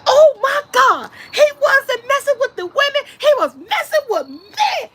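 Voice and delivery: high, excited mock scream; retold scream. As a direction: yell it in high-pitched shock. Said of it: "I yelled and screamed".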